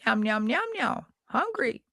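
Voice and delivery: high meowing puppet cat; Henrietta Pussycat. Retold or imitated. Imitating Henrietta Pussycat's meow between words. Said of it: "inserting meow before her sentences".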